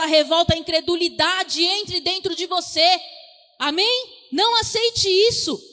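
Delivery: loud, driving sermon declaration; full-force preaching. Acted. Preacher urging the congregation to declare joy, loud and driving. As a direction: proclaim it loud to lift the room.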